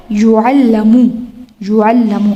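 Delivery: "یعلم" drawn out as yu'allamu; present passive vowels. Shown as a demonstration. Teacher shows students the present passive, slow "یعلم" as yu'allamu. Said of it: "او آ۔".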